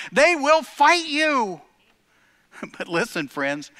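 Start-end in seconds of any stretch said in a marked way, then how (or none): 0.1-1.6 high, loud, slow cry; rally the congregation; impassioned cry. Acted. Preaching at full force.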